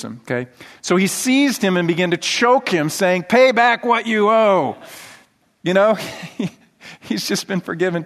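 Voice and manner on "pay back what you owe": harsh demand. Acted. Slave choking fellow slave.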